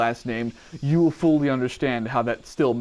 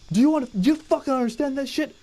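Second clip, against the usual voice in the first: drill sergeant; 11 semitones higher, faster than usual. Retold or imitated. Drill sergeant yelling at recruit.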